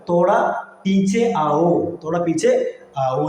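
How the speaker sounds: "തോടാ പീച്ചെ ആവോ" slowly, each word separate; drawn-out, careful "തോടാ പീച്ചെ ആവോ"; slow teaching breakdown. Shown as a demonstration. Teacher breaks phrase into words.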